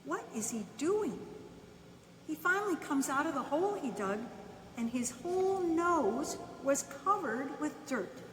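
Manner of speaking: ordinary delivery, nothing marked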